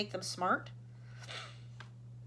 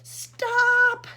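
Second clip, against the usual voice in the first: high, loud scream of stop; a piercing loud scream; 15 dB louder than usual. A narrator's reading. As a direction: scream to cut him off. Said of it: "screamed ground control".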